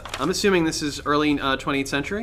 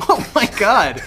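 laughing; 8 semitones higher, 7 dB louder than usual. Real, not acted. Laughing at the joke.

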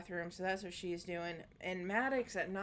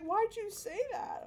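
put-on high child voice; 12 semitones higher than usual.

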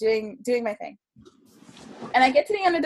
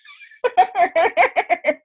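high, loud burst of laughter; hearty laugh; 9 semitones higher, 6 dB louder than usual. Real, not acted. Storyteller amused at her own celebrity tale, hearty laugh. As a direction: let out a long, tickled laugh. Laughs after naming the psychic network.

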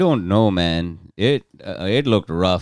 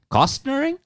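incredulous. Real, not acted. Incredulous echo of new word.

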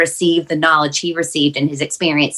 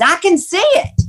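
voicing Dad's sudden high, louder cry of wonder; delighted outburst; 13 semitones higher, 4 dB louder than usual. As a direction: exclaim it high and thrilled, retelling his joy. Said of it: "all of a sudden he starts laughing".

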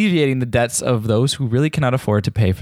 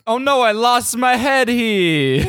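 high mock panic; 10 semitones higher than usual. Two-headed character losing head.

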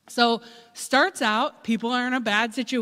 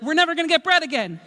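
hurried panic; 6 semitones higher, 5 dB louder, much faster than usual. Acted. Panicked hoarding.

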